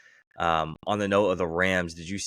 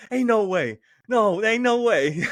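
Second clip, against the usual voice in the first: much higher and louder, stretched out in disbelief; excited disbelief; 7 dB louder than usual. Retold or imitated. A player greets his college teammate, excited disbelief. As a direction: squeal it like you can't believe your luck. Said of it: "He goes".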